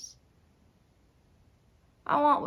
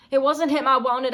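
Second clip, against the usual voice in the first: loud, insistent pleading; urgent protest; 2 semitones higher, 8 dB louder than usual. Acted. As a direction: protest it loudly to the husband. Pleading confession to husband.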